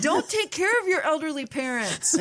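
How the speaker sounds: high-pitched heckle at the screen; hammed-up warning cry, absurdly earnest; mock yelling. Viewer yelling caretaker advice at the screen, mock warning. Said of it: "yelling at the screen like".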